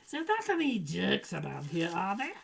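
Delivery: rusty, put-on German accent; half-remembered German accent. Shown as a demonstration. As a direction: attempt a comic German accent on request. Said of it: "to do your German accent".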